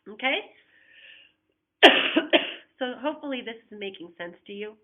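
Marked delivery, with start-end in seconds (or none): 1.8-2.7 rough repeated cough. Real, not acted. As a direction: cough it out hard. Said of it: "Sorry for coughing".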